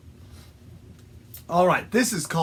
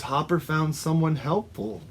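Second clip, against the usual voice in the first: ordinary delivery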